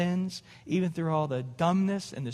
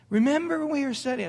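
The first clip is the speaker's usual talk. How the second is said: mock insistent; 7 semitones higher, faster than usual. Retelling argument with his wife.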